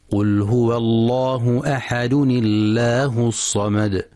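recited, "أحد الله" joined as ahaduni-llah; linked thin lam. Shown as a demonstration. Teacher reciting the verse linked, tanween broken with kasra. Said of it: "تصبح اللام في لفظ الجلالة مسبوقة بكسرة".

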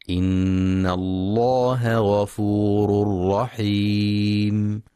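slow, drawn-out tajweed chant; Quran recitation. Acted. Teacher reciting the verse to listeners, slow tajweed chant. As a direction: recite each phrase slowly and melodically.

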